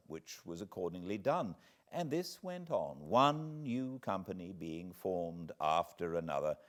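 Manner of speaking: put-on calm, measured storyteller reading an old memoir; fireside reading. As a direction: read it like a tale by the fire. Reads Franklin autobiography aloud.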